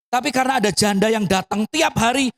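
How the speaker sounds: normal talking voice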